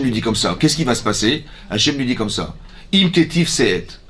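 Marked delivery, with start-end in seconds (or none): 2.9-4.0 slow Hebrew verse. Acted. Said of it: "Hebrew verse chanted".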